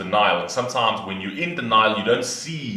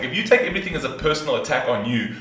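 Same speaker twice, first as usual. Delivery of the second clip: normal talking voice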